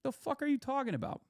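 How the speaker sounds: baffled retort. Retold or imitated. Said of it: "And I was like".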